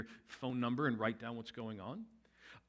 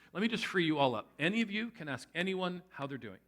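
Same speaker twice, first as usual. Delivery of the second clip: ordinary talk, nothing marked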